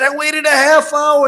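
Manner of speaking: shouted high and loud, fed up; angry scream. Retold or imitated. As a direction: yell it like a furious customer. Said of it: "and he's screaming".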